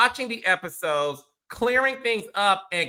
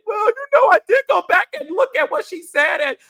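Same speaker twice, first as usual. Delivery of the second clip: high, loud mocking whiny voice; sarcastic high mimicry of the cast; mocking castmates; 11 semitones higher, 4 dB louder, faster than usual. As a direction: mimic them, hammed-up and high. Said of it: "y'all had sympathy ... and said".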